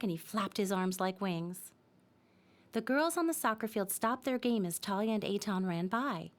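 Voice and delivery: ordinary delivery, nothing marked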